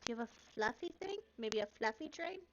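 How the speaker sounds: high, very fast hopeful question; eager little girl. Acted.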